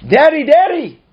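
loud, high cry for help; yelling. Retold or imitated. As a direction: cry out like a desperate child. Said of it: "you start yelling".

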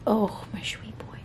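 soft whisper to the cat; tender whisper. Real, not acted. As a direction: breathe it lovingly to the pet.

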